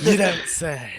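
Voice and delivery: exaggerated sarcastic meme delivery; Nicolas Cage. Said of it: "that Nicolas Cage meme".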